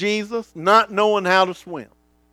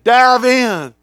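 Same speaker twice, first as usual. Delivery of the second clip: higher, louder confident urging; Dad calling; 6 semitones higher, 7 dB louder than usual. Retold or imitated. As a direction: cheer him into the water. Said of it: "Dad saying".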